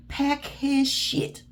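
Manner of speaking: very slow, high, word-by-word order; slow, insistent, repeated command; emphatic command. Real, not acted. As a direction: stretch out each word as a firm instruction. Urging her to throw him out.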